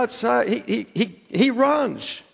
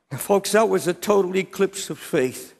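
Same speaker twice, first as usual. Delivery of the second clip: ordinary delivery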